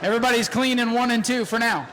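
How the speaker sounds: rapid-fire play-by-play, rising as the cars close in; live race call. Acted.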